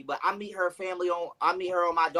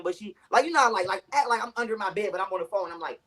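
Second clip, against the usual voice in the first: normal talking voice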